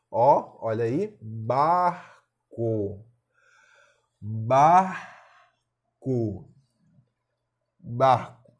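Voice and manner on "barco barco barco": "barco" broken into bar... co, loud; syllable by syllable. Shown as a demonstration. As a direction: split "barco" in two with a long gap, bar loud and drawn out. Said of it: "Bora fazer a separação silábica".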